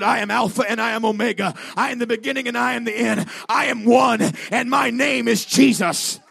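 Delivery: loud, high proclamation, building to a shout; booming divine voice. Retold or imitated. Jesus answering the man, shouted proclamation. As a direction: declare it at full preaching force. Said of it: "the voice spoke back and said".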